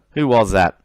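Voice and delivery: usual speaking voice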